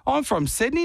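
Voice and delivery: fake Australian accent. Claiming Sydney to the stranger, put-on Aussie accent. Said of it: "they go".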